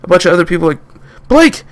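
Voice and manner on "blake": high, loud hailing; calling out. Acted. People recognising Blake and Derek: high loud calling. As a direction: call across to them. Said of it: "they called out".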